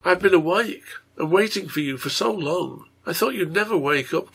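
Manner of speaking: a hint of a devoted little girl; lightly voiced, cheerful child; lightly voiced child Mary.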